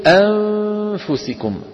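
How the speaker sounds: أنفسكم with the نون hidden, held nasal hum; nasalized ikhfa. Shown as a demonstration. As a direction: stretch the hidden نون in أنفسكم through the nose. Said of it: "لما منقول".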